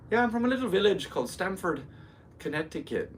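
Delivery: high-pitched, lilting put-on Irish accent; fake Irish accent. Shown as a demonstration. Telling the crowd his hometown, fake Irish accent. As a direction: play the villager in a stage Irish brogue. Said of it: "with that accent".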